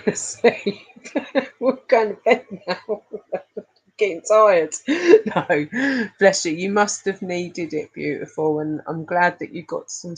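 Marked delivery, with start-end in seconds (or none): 4.8-5.3 high squealing laugh; laughing burst. Real, not acted. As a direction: break into a shrieking giggle.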